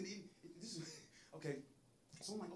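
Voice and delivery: ordinary talk, nothing marked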